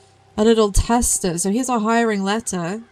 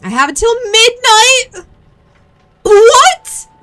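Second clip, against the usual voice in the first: much higher and louder, stunned; can't believe how little time there is; real alarmed disbelief; shocked disbelief; 14 semitones higher, 10 dB louder than usual. Real, not acted. Shocked at the deadline.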